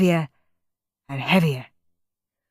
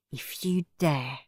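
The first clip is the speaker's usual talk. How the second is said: low threat; 3 semitones lower, 8 dB quieter than usual. A narrator's reading. Henry threatening quietly.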